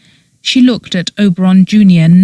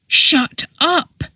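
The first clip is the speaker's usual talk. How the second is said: a high, sharp snarl; 9 semitones higher, 4 dB quieter than usual. A narrator's reading. Oberon cutting Petra off, snarled. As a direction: snap at her to stop. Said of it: "he snarled".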